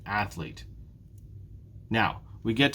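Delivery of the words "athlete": athlete low and soft, weight on ATH; stress on first syllable. Shown as a demonstration. Teacher confirming the stressed syllable, "athlete" stressed on "ath". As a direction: say "athlete" joined up, leaning on "ath". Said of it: "It's the first syllable again".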